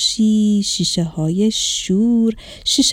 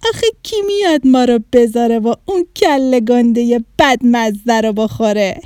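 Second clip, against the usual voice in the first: loud, jeering cackle into a taunt; scornful bully laughing out loud; mocking laughter; 4 dB louder than usual. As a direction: laugh at them, then taunt loudly. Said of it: "زد زیر خنده و گفت".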